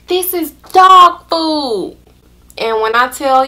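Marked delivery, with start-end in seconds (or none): 0.1-2.0 slow, higher mock-disgusted friend; higher, slow dismayed voice; friend's disgusted retort. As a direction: draw out her disbelief, slow. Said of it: "She's like".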